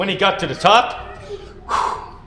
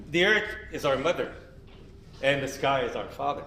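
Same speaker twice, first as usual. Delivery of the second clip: lower, softer, fading reverent words; solemn gentle telling; 5 semitones lower, 9 dB quieter than usual. Acted. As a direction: speak it like sacred teaching. Solemn teaching closing.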